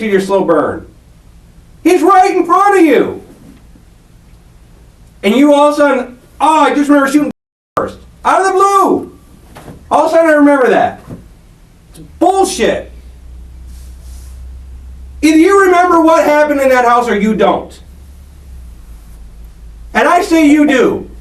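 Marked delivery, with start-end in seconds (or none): 1.8-3.2 high-pitched, emphatic accusation; real heated insistence. Real, not acted. Interrogator confronting suspect with his real target, heatedly insistent. 5.2-12.9 high, exasperated mockery; scornful disbelief. Real, not acted. Interrogator dismissing suspect's convenient memory, scornful. 15.2-21.1 real insistent challenge. Real, not acted. Interrogator demands admission of memory.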